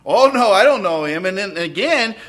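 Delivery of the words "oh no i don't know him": loud, hasty denial; a loud panicked no; Peter's loud denial. Acted. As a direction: brush it off loudly.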